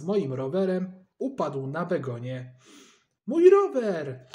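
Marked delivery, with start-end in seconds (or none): 3.3-4.3 much higher, much louder slow anguished yell; cry out. A narrator's reading. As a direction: shout for the fallen bike. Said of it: "krzyknąłem".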